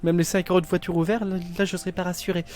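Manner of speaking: a touch of nervous unease; mildly uneasy. Real, not acted. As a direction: start fretting about the downpour, lightly. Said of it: "je commence à, à pas être bien".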